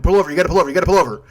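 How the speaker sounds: loud, high, frantic repeated order; urgent yelling. Retold or imitated. Panicked friend ordering the driver to stop, loud frantic yell. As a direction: shout it like a panicked passenger. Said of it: "if you're telling me at that point".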